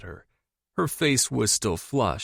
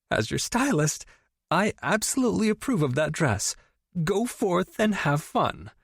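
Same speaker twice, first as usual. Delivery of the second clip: mock serious; 9 semitones higher than usual. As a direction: pretend to be all business. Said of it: "trying to be serious".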